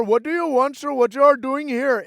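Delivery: imitating the daycare woman at the door; mock challenge at the door; mock accented woman at the door. Retold Somali woman at door.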